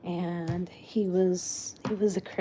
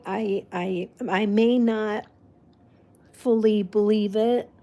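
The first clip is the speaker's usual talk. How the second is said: ordinary talk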